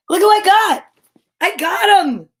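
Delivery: high, loud, gleeful; triumphant excitement. Real, not acted. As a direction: crow over catching him. Triumph at catching the cat.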